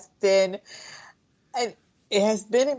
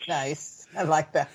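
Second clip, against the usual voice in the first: ordinary talk, nothing marked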